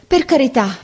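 high begging, first plea; pleading. A narrator's reading. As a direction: plead once, urgently. Boy begs, repeating phrase. («per carità» supplicato, acuto)

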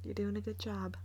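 hushed. Real, not acted. To Rosie so the murderer doesn't hear, hushed. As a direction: keep it very quiet, hiding from danger. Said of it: "Gotta be quiet".

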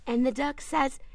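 ordinary talk, nothing marked

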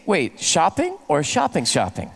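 wife mimicked stressing "shopping, shopping"; double word stress. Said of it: "And I heard her say".

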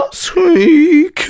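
tiny hamster squeak. Retold or imitated. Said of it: "he squeaks sadly".

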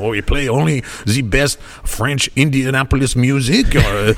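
slow, hammed-up French DJ voice; a French radio host's hammy drawl; comedy French accent. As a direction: purr the DJ names in a mock French accent. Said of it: "just go right into that voice".

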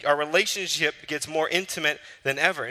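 usual speaking voice

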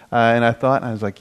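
ordinary delivery

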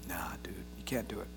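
a hushed, resigned whisper of defeat. Acted. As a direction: whisper it like giving up. Voicing weary Solomon's resignation.

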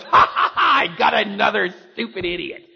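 cackling, loud mock-evil laughter. Preacher mimics a cruel God laughing at believers, loud cackle. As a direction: laugh it out like a gloating tyrant. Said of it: "And God goes".

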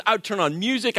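usual speaking voice

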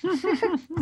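high laugh at the punchline; burst of laughter. Real, not acted.

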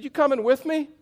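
laughing joke. Retold or imitated. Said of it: "I have never been funnier in my life".

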